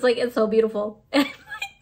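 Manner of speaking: teary laugh breaking through; laughing through tears. Real, not acted. Vlogger laughing at herself tearing up, teary laugh. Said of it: "tearing up over a Funko Pop".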